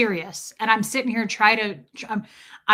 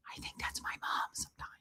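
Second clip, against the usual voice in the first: whispered aside; 17 dB quieter than usual. Real, not acted. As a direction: whisper it like a confession. Aside confessing about mom.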